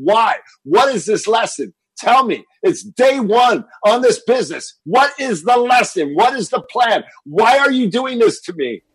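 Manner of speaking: loud, high frustrated yelling; re-living a furious outburst in traffic; angry outcry. Retold or imitated. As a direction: shout it up at the sky, exasperated. Frustrated shout at the sky.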